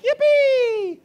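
sarcastic over-the-top celebration; mock glee. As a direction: ham up a giddy whoop of delight. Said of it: "that they're just like".

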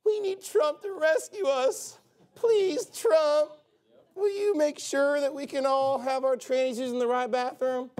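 high, whiny mock begging voice; mock whiny pleading. Preacher mocking Christians begging Trump for rescue, high and whiny. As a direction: beg Trump like a helpless whiner, hammed up. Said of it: "They wouldn't have been like".

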